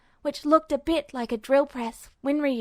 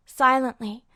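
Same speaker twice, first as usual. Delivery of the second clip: plain reading voice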